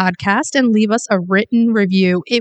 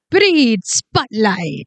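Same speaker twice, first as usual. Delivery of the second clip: slow, drawn-out segment title; dramatic announcement; much slower than usual. Pretend. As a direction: announce it like a big show reveal. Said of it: "I'm not sure what that was".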